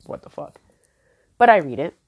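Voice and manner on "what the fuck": whispered. Retold or imitated. Taken aback reading the text.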